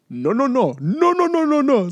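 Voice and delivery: high, loud, rapid-fire protest; alarmed stranger imitation. Man in the car stopping a drunk intruder, mock alarm. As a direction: mimic a startled driver refusing entry. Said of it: "Indian dude in the car".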